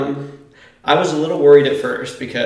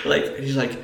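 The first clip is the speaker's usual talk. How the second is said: usual speaking voice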